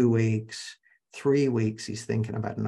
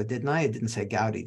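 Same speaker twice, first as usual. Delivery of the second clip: ordinary talk, nothing marked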